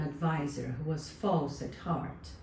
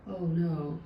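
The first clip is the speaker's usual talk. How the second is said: plain reading voice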